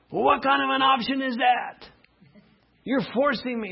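high, put-upon objection; indignant sinner. Acted. Sinner protesting to God about being forced. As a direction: protest like someone being cornered.

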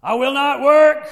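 loud, high defiant cry; loud defiance. Acted. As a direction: shout it in defiance. Line shouted, no naming.

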